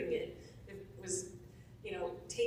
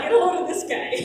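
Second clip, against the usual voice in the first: laughing aside; 8 semitones higher, 15 dB louder, faster than usual. Retold or imitated. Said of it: "I'm like".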